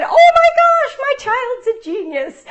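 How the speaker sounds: high, loud gushing squeal; thrilled mom. Retold or imitated. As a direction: gush like a proud mother. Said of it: "I turned around and I said".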